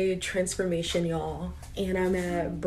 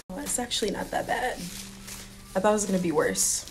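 usual speaking voice

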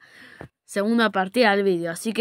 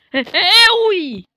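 high loud cry; alarmed shout; 9 semitones higher, 7 dB louder, slower than usual. Real, not acted. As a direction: yell in alarm.

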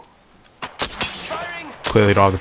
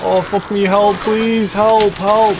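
high, urgent cries for help; desperate pleading; 12 semitones higher, much slower than usual. Real, not acted. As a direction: beg for help in real panic. Begging teammate for help.

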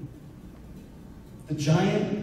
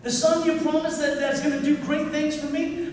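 higher, taunting voice of fear; 10 semitones higher, 4 dB quieter than usual. Retold or imitated. Fear taunting the father in the hospital, higher and mocking. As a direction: play fear as a mocking intruder. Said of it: "Fear was knocking on my door".

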